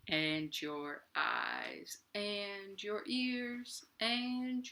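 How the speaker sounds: very slow, soft, stretched singing; slow-motion kids' song; really slow. Shown as a demonstration. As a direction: drag every word like moving through Jell-O. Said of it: "let's do it really slow, like we're going through Jell-O".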